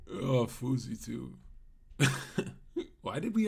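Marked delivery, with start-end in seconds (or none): none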